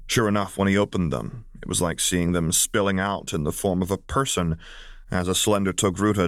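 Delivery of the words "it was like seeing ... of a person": ordinary delivery, nothing marked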